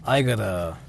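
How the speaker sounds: ordinary delivery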